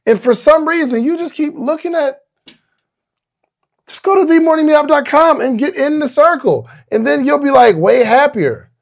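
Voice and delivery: loud, fast, upbeat sponsor read. Acted. Host pitching the meetup to listeners, loud and fast. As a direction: sell it like a pumped-up ad spot.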